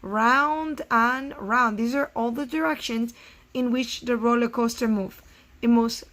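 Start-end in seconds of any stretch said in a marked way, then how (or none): 0.0-1.8 slow read-aloud. Shown as a demonstration. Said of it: "reads slowly for class".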